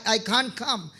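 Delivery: ordinary delivery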